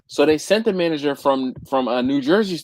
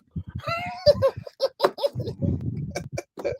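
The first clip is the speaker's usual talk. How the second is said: high, squealing long laugh; helpless laughter; 6 dB quieter than usual. Real, not acted. Cracking up at his own story, helpless high laughter. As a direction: crack up completely, high-pitched.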